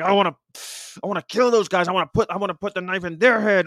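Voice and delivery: high, loud mock rage; hammed-up furious outburst; imitated fury. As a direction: rant like an outraged onlooker. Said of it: "he's really upset".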